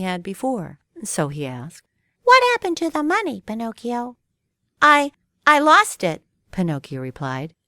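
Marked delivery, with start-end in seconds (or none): 2.2-4.2 high, fast questioning cricket. Acted. 4.8-6.2 put-on higher, louder faltering puppet boy; stammering lie.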